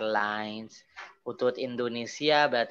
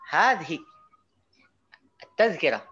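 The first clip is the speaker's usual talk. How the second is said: raised "Hadhi", long pause, then "Tazkirah"; "Hadhi" and "Tazkirah" as two stressed pieces; pause with intonation; 3 semitones higher, 4 dB louder than usual. Shown as a demonstration. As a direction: lift "Hadhi" and break before "Tazkirah". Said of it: "Ada jeda begitu pakai intonasi".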